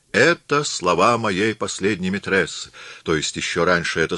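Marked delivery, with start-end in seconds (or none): none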